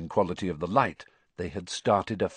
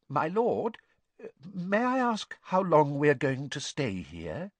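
timid; 5 semitones higher than usual. A narrator's reading. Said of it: "timid and fearful".

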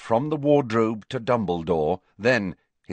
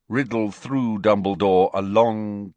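usual speaking voice

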